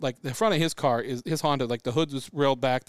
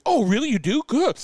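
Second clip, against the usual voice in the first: high, louder delighted surprise; the cop suddenly thrilled; imitating cop's surprise; 9 semitones higher, 6 dB louder than usual. Retelling a cop's delight, high mock surprise. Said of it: "he's like".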